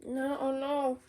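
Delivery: ordinary delivery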